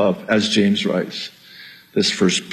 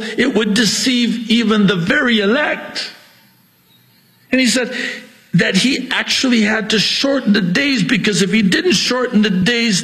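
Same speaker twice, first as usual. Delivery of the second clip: high-pitched, driving pulpit delivery; fiery preaching; 10 semitones higher than usual. Acted. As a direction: preach it hard and high, full conviction. Preaching at full force.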